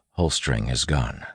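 plain reading voice

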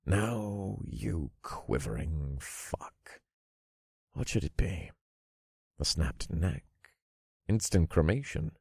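soft, unhurried taunting; quiet menace. Acted. Narrator offering the warlock deaths to pick, quietly menacing. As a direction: dangle each option softly before the victim.

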